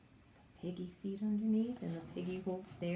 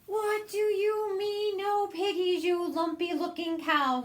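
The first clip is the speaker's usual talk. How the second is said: much higher, loud witch scream; 10 semitones higher, 4 dB louder than usual. Acted. Witch screaming at the cow, high and loud. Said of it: "screamed Gritch".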